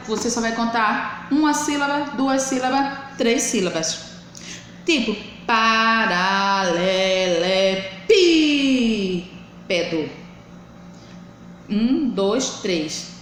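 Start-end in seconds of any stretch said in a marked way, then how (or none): 5.5-10.1 pa-ra-le-le-PÍÍÍ-pedo stress demo; stressed syllable drawn out. Shown as a demonstration. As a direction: count out "paralelepípedo" slowly, leaping up on PÍ. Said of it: "pronunciada com mais força".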